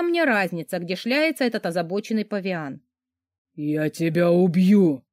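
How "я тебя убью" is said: louder, slow growling threat; angry growl. Acted. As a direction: growl the threat through the teeth. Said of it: "прорычал злой великан".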